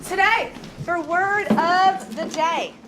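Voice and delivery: high, slow announcing to class; drawn-out classroom call; sing-song teacher voice. Real, not acted.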